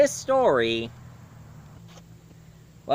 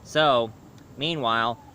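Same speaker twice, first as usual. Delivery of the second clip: plain reading voice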